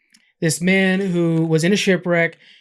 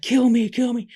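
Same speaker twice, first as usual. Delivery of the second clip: retold yell, pitched up but held back; mock yelling; 6 semitones higher than usual. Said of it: "like, yelling at him".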